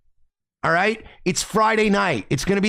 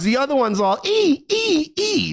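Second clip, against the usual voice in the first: high squeaky ee ee ee, much higher; mock squeals; 9 semitones higher than usual. Roommate imitating the other girl's high squeals. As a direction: squeal like a mouse to mock her. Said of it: "He goes".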